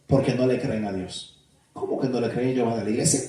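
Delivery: a churchgoer grumbling under the breath, offended. Retold or imitated. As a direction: churchgoer protesting quietly that he goes to church.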